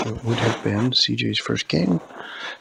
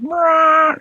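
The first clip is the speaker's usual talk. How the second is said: much higher, loud brrrr; jump scare roar; 11 dB louder than usual. Acted. Elvie tries his big scare, loud high brrrr. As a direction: blast it out to spook. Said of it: "And he went".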